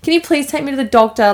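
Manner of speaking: mock desperate begging, no real tears; retold tearful pleading. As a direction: plead fast and high, imitating an upset kid. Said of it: "I was in tears, hysterics".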